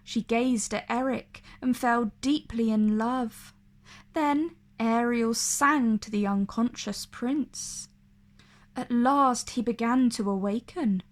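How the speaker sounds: ordinary delivery, nothing marked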